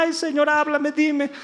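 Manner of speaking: high, pleading mock whine; mock whimpering. Believer begging the Señor to cause pena, mock whimpering. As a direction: beg for pity, hammed-up. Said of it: "en tu lloriqueo".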